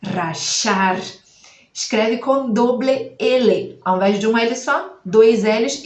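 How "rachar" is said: rachar with the y said as sh; sh variant. Shown as a demonstration. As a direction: use the voiceless sh of Buenos Aires in rachar. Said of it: "mas pode ser".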